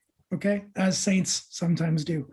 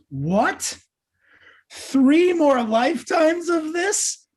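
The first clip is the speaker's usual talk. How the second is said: a higher, louder, slow drawn-out cry of outrage; outraged disbelief; 11 semitones higher, 6 dB louder, much slower than usual. Acted. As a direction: stretch out three like you can't believe it. Old practitioner outraged at news.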